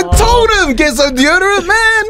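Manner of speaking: very high-pitched, half-laughing protest; exasperated outburst. Real, not acted. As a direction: wail your vindication through the laughter. Exasperated, laughing after telling the message.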